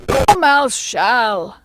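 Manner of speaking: loud, high, drawn-out call; crying out. A narrator's reading. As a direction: cry it out long and loud. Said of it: "they both cried".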